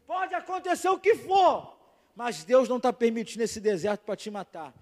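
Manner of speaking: loud, slow, emphatic pulpit preaching; preaching at full force. Acted. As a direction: preach it out to the whole church, building. Pastor preaching to congregation. (pregação inflamada, em voz alta)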